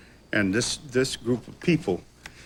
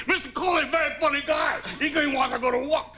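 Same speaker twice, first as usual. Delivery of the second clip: much higher, near-shouted mock Chinese accent, quick at the end; hammed-up doctor announcing it to the nurses; doctor telling the whole ward, exaggerated high accent; Chinese takeout cook impression; 14 semitones higher, faster than usual. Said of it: "began to talk like, you know, the, the cook in a Chinese takeout restaurant".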